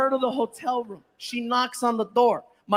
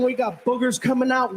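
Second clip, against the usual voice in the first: ordinary delivery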